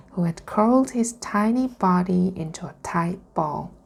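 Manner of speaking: ordinary delivery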